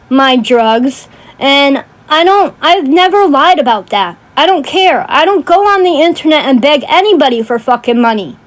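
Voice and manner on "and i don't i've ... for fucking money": usual speaking voice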